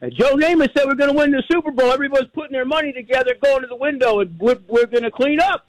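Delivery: imitating dad, high-pitched and urgent; excited dad. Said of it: "He goes".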